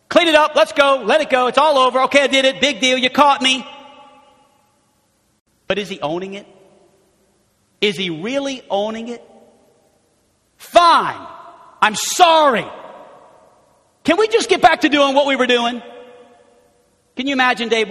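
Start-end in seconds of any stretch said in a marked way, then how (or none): 0.1-3.6 loud, high brush-off; impatient. Acted. Voicing impatient confession. 10.6-15.8 loud, high grudging sorry; angry apology. Acted.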